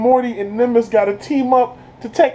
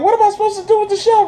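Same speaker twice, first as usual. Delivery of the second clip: high and very fast; Summer confused; 10 semitones higher, much faster than usual. Retold or imitated. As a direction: blurt it out in a rushed, high girl's voice. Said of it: "Summer's like".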